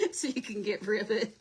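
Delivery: loud, amused, laughing through the words. Real, not acted. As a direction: read it out while cracking up. Reading viewer comment through laughter.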